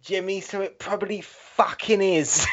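loud, hammed-up tirade; mock angry outburst. Host telling Scott off, loud mock-angry outburst. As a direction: yell it in playful outrage. Mock rant about birthplace.

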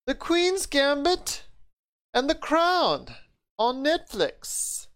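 high-pitched, hammed-up British accent; mock British announcement, slow and high; horrible British accent. Host announcing two Netflix shows, in a put-on British accent. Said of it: "horrible British accent".

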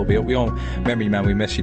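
usual speaking voice

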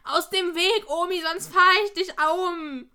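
mock yell at a granny blocking the way; rude yell. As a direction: bellow at the old woman to get off the road. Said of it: "weil Freddy ruft nur".

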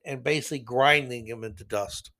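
ordinary talk

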